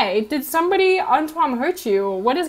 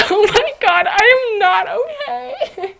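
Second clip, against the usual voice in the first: high, drawn-out wail through laughter; helpless laughter; 8 semitones higher, slower than usual. Real, not acted. Vlogger losing her thread with viewers, laughing wail. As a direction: crack up until it sounds like crying.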